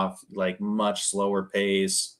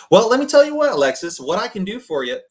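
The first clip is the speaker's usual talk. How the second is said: much higher and louder, pushy pitch; pushy salesman voice; 10 semitones higher, 8 dB louder, faster than usual. Shown as a demonstration. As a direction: barge in like an overeager salesman. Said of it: "that breaks rapport".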